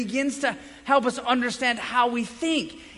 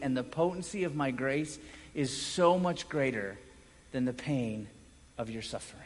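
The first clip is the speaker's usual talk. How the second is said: much softer and lower, gently reassuring; reassuring voice of grace; 6 semitones lower, 4 dB quieter than usual. Acted. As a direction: speak as grace comforting the sufferer. Voicing grace speaking to the sufferer.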